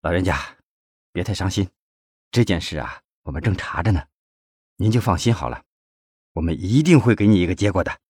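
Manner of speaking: soft, gentle reassurance; comforting. A narrator's reading. Secretary consoles the grieving old man, softly comforting. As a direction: quietly console him, firming slightly on the promise. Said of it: "并安慰老人道".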